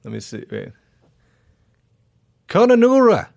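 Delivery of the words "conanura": put-on higher, louder Australian accent. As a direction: say it with an Aussie twang. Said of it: "in an Australian accent for me".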